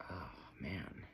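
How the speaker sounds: breathy whispered groan. Real, not acted. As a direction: sigh it out under your breath. Itchy elbow, aside to self.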